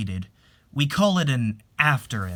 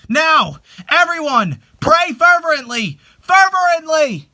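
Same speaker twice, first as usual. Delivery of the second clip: much higher and louder, slow fervent plea; impassioned cry; 10 dB louder, slower than usual. Acted. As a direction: lift the whole room into prayer. Climax of the prayer ritual.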